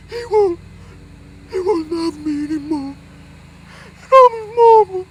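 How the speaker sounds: high, loud falsetto sob; overbearing mother wailing she can't stop helping her son; hammed-up crying mama. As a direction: wail like a smothering mother.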